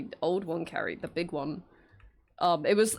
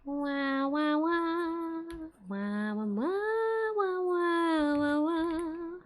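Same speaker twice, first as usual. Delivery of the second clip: mock baby wah; 8 semitones higher, much slower than usual. Mock baby crying sounds.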